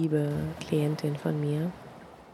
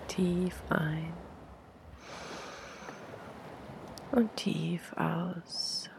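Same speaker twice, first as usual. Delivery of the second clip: guided meditation; 6 dB quieter than usual. Acted. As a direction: breathe it out softly, long pauses.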